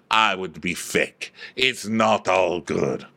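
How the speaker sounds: slow, low melodramatic pronouncement; telenovela drama. Retold or imitated. Diana confronting Sutton, low telenovela drama. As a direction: draw it out low and grave. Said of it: "goes for the full telenovela confrontation".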